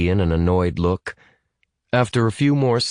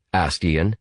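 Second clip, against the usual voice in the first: usual speaking voice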